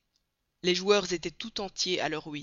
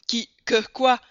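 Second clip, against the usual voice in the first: indignés; 5 semitones higher, 9 dB louder than usual. A narrator's reading. Voyageurs s'écrient.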